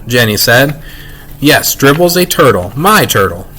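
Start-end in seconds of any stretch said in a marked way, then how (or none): none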